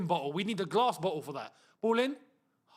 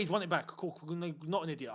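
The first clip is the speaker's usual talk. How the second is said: ordinary talk, nothing marked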